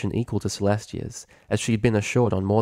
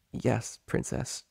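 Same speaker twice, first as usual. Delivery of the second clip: softer, slow timid reply; tiny creak; 3 semitones higher, 8 dB quieter, slower than usual. Acted. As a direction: squeak it out fearfully. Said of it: "a tiny creak in a stone door".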